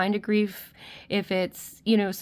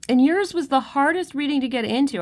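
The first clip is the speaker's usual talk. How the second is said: higher and louder, pushing through tears; tears breaking into the words; tearful; 6 semitones higher, 5 dB louder than usual. Real, not acted. Reader to group three, louder and teary. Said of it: "I didn't wanna cry again".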